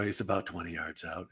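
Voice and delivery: hushed, low golf commentator; golf announcer voice. Retold or imitated. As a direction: murmur it like a golf broadcast. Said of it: "I'm doing the golf voice".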